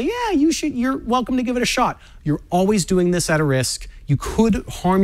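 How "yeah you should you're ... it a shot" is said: nonchalant. Retold or imitated. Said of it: "nonchalantly say".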